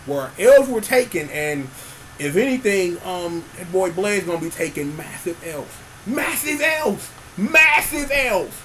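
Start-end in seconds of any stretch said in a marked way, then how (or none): 6.1-8.5 hyped exclaim. Pretend. Hyping the loss.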